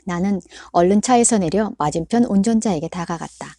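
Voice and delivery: usual speaking voice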